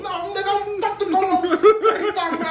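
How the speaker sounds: high loud exasperated shout through laughter; indignant outburst. Retold or imitated. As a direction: yell it like you refuse to drive further. Acting the driver's outburst.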